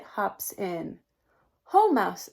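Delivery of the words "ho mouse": louder cheery rabbit greeting; friendly rabbit. Acted. Rabbit greeting mouse at the den, cheery call. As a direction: greet your old friend brightly. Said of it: "says Rabbit".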